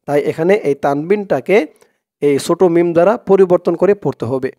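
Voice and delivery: ordinary talk, nothing marked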